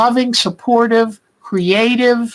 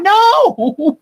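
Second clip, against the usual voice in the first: high, startled no; 12 semitones higher, 3 dB louder than usual. Retold or imitated. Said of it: "I went".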